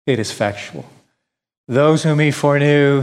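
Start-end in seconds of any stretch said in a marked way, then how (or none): 0.1-0.9 "It is effectual" dropped low and soft; hushed emphasis. Shown as a demonstration. Preacher repeating to the congregation, hushed. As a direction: say it again, lower and softer, for weight.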